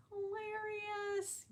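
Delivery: "Hilarious" drawn out slow, high and soft; slow high quiet "Hilarious"; squeaky high hilarious. Shown as a demonstration.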